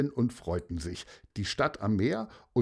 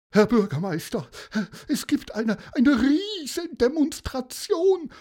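much higher, squeaky flustered adviser voice; agitated adviser; 4 dB louder than usual. Acted. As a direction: play the panicky adviser bursting in. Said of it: "sagte der Berater".

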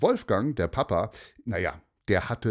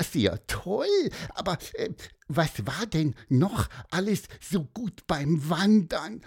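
very high, piping little bird; squeaky bird voice; 8 semitones higher than usual. A narrator's reading. Pieper the bird muses to the children, high piping squeak. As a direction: chirp it out like a small bird.

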